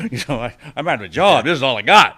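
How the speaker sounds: protest. Retold or imitated. Protesting losing drug money.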